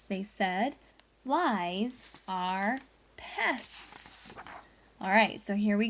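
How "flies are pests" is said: drawn-out disdainful verdict; slow judges' verdict. Acted. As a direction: spell it out slowly like a judge. Judges voiced in story.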